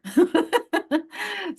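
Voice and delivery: high, hearty burst of laughter. Real, not acted. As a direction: laugh freely at your own remark. Laughs after own remark.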